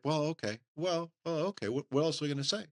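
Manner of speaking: high, mildly whiny mock parent shrugging it off; a light mock of meek parents; helpless parents. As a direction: play the clueless parents giving in. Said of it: "the parents are like".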